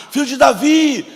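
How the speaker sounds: clamor alto e encenado; grito do cego. Acted. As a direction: grite como o cego clamando. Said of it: "o cego começa a gritar". (clamando alto, como o cego)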